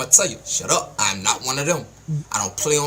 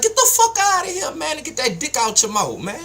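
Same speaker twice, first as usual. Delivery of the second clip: very high-pitched, exasperated outburst; triggered; 13 semitones higher than usual. Real, not acted. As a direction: blow up at them, voice shooting up. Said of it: "I get triggered easily".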